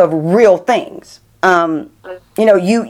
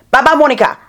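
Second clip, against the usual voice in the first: higher-pitched imitation British accent; lightly put-on British accent; 7 semitones higher, faster than usual. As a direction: call out a farewell in mock British. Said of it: "in that British accent".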